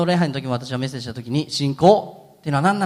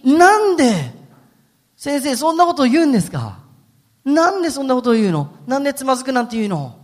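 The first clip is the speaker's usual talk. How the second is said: higher, louder, indignant why-questions; hurt protest; 9 semitones higher, 5 dB louder than usual. Acted. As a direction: Peter can't believe his teacher doubts him. Peter protests to Jesus.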